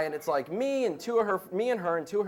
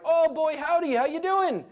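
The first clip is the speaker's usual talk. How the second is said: much higher, louder cartoon yokel greeting; hammed-up Simpsons American; 12 semitones higher, 6 dB louder than usual. Simpsons Americans greeting Japan visitors, hammed-up cartoon yokel. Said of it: "that Simpsons episode ... that American".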